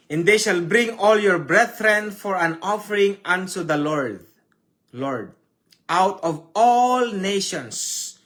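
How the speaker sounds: solemn preached verse; scripture reading. Acted.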